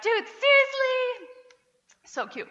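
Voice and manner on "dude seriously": put-on high, loud, slow child scream, much higher and louder; toddler screaming imitation. Mom imitating her daughter screaming it in the video, high and loud. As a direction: belt it like a delighted toddler. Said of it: "screaming out".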